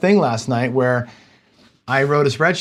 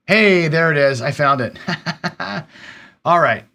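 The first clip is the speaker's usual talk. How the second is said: relieved delight; 4 semitones higher than usual. Real, not acted. Streamer finding his lost file, delighted and laughing. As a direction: exclaim your find, then laugh with relief.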